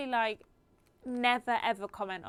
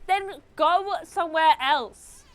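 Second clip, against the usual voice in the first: slow, loud, fed-up comeback; loud retort; 6 semitones higher, 10 dB louder, much slower than usual. Retold or imitated. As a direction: snap back, drawn out and loud. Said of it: "And I'm like".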